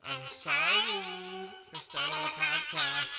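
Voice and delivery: squeaky, very slow sorry. Shown as a demonstration. Apologizing for the podcast in a voice-changer squeak. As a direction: stretch it out through a chipmunk filter. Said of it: "talk into this little voice changer".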